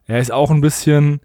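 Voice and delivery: normal talking voice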